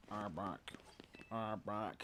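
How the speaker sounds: flat deadpan monotone. Shown as a demonstration. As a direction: say it with no life at all. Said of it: "monotone voice put over it".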